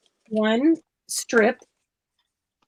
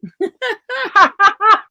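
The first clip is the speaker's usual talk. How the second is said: a loud, high burst of laughter; laughing; 9 semitones higher, 5 dB louder than usual. Real, not acted. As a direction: cracks up at her own accent attempt. Laughing at her own accent attempt.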